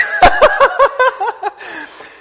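high, loud burst of laughter. Real, not acted. Preacher laughing at story.

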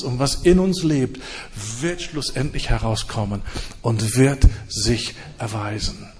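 usual speaking voice

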